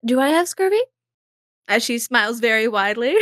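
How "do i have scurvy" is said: higher, wide-smiling flirty question; coy Izzy. Acted. Izzy to Benny, joking about scurvy, higher smiling flirt. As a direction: ask it through a big grin.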